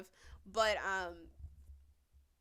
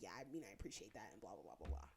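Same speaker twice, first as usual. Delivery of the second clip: lower, softer, fast dismissive parody; lower, quick sarcastic imitation; mocking mumble; 12 semitones lower, 7 dB quieter, faster than usual. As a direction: rattle it off like it means nothing. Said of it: "he was like".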